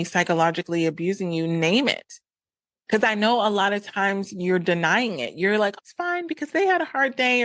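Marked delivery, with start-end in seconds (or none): 5.8-7.4 high-pitched imitation of excusing a partner; mock denial, pitched way up; making excuses. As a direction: brush it off in a much higher voice. Imagined excuse from someone in denial.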